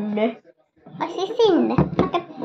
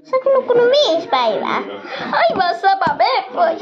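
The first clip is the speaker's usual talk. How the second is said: lightly squeaky little creature; high toy voice; 7 semitones higher than usual. Pretend. Little toy telling Tikru it slept five days, high. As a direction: say it high and insistent.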